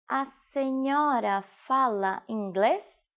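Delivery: slow, drawn-out model question; slow teaching repetition. Shown as a demonstration. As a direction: stretch "A senhora fala inglês" out syllable by syllable. Teacher repeats phrase slowly for learners. (slowed right down for language learners)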